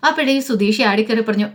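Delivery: plain reading voice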